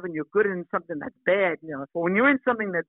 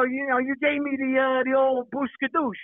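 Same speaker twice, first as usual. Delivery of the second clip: higher, slow mock line delivery; lightly hammed-up movie quote; quoting movie line; 6 semitones higher than usual. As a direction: drawl out the old catchphrase for effect.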